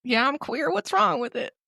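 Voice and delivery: put-on high, defiant old Southerner; old Southern self. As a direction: challenge them as an elderly Southerner. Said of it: "I gave my old self a, a Southern accent".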